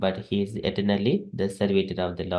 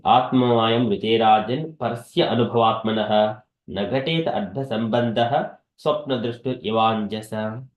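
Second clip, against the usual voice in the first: verse recitation; 3 dB louder than usual. Acted. Teacher reciting the verse to devotees, chanted recital. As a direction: lift the Sanskrit verse out in a steady chanting recital, a touch louder.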